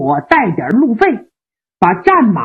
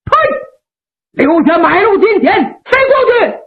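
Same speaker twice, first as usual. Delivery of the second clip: bandit's shout; 7 semitones higher than usual. Acted. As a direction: bellow it out from the trees to stop the rider. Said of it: "突然有人高喊".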